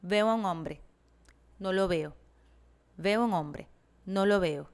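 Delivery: every syllable of Veo a un hombre given equal weight; even syllable-timed rhythm. Shown as a demonstration. Teacher demonstrates Spanish rhythm, even syllable beats. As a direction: tap out each syllable evenly, like a metronome. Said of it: "in Spanish, we would say something like".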